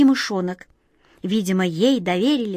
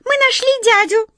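loud, very high little squeak; high cartoon mouse voice; squeaky mouse; 5 dB louder than usual. A narrator's reading. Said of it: "пискнула одна из них".

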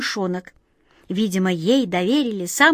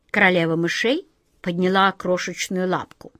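usual speaking voice

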